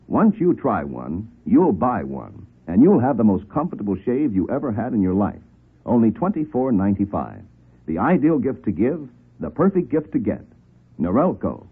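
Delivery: usual speaking voice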